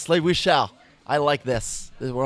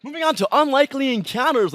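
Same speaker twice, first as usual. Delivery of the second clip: announcer voice; 11 semitones higher than usual. Acted. Show host announcing segment.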